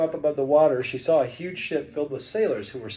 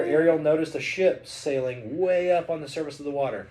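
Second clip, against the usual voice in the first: ordinary delivery, nothing marked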